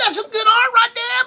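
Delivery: quick high falsetto, faking enthusiasm; hammed-up high put-on voice; mock praise. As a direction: squeak it out like fake praise. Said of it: "I'm telling him".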